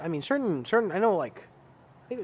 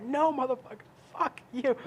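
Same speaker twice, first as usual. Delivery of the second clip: high, strained curse at the robocall; high pitched furious retort; angry high curse; 10 semitones higher than usual. Retold or imitated. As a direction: spit it at the phone. Retells cursing at robocall.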